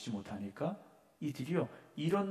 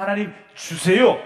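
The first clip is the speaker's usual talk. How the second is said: high, loud, grabby plea to God; mock demanding prayer; 8 semitones higher, 14 dB louder than usual. Preacher mocking a believer who just demands from God, high and loud. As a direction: act out a greedy prayer, pitched up and loud. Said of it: "이게 아니라 하나님께 물어보라는 거예요".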